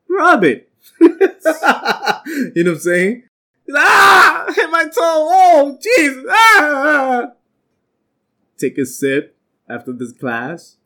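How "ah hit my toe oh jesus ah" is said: hammed-up cry of pain, much higher and louder; mock pain yell. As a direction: yelp like you just stubbed your toe. Acts out hitting his toe.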